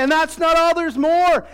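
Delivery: loud, high TV salesman hype; infomercial pitchman. Retold or imitated. Preacher hamming up an infomercial pitch, loud and high. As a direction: sell it like a late-night ad. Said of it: "he goes on there".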